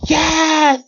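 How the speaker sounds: high, drawn-out mock yell; acted shout. Reader voicing Machia obeying the command, acted shout. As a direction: belt it out on a high note. Acting out manga scream.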